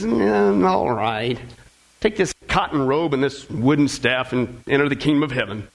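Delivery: low, offhand, underwhelmed delivery; casual. Acted. Saint Peter to the minister, casual and offhand. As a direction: hand it over casually, pitch dropped low. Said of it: "he kinda casually says".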